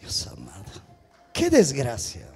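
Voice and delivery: very low, soft murmur; hushed dismay. Real, not acted. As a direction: murmur it under your breath. Preacher murmurs dismay after mockery. (lamento murmurado, muy bajo)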